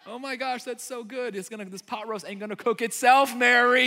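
high fake cheer building into a loud jab; frustrated Martha. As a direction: start sweetly high, then blow up at your sister. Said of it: "starts to get a little frustrated with Mary".

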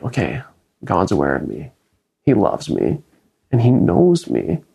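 hushed, comforting self-talk. Retold or imitated. Reassuring himself after hard news.

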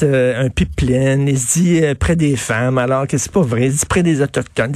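usual speaking voice

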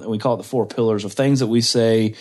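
normal talking voice

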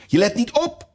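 high-pitched sharp scolding; stern. A narrator's reading. Mouse catching Alice not listening, stern. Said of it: "zei de muis streng tot Alice".

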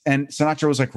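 normal talking voice

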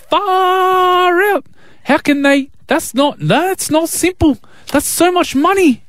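put-on high-pitched New Zealand accent; Kiwi accent. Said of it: "I just wanna do a Kiwi accent".

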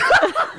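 loud burst of laughter. Real, not acted. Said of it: "Why are you laughing out loud like that".

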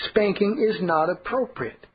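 usual speaking voice

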